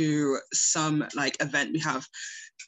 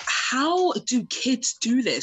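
baffled; 7 semitones higher than usual. Retold or imitated. Quoting her lost self.

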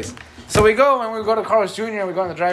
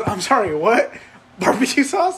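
retold amused disbelief; 2 semitones higher than usual. Imitating drive-thru guy checking the drink order, puzzled. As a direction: act out a baffled double-check. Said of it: "I can hear him laughing".

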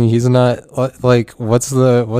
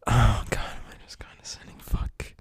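worried whisper to yourself, almost no voice; whispered self-doubt; 11 dB quieter than usual. Acted. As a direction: sink into a barely audible whisper. Whispered worry about being condescending.

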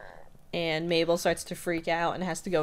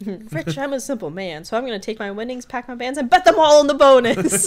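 excited, building to laughing yell; 6 semitones higher, 10 dB louder than usual. Retold or imitated. Game show contestant impression.

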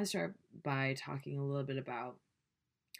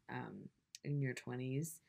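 ordinary talk, nothing marked

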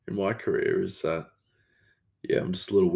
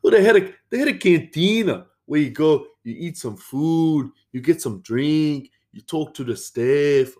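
put-on South American accent; 6 semitones higher, 4 dB louder than usual. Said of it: "like, a South American thing".

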